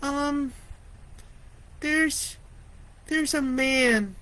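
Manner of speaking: high, slow, hesitant little voice; a frightened child barely gets it out; timid stammer. Acted. Lori stammers to the dispatcher, small and scared.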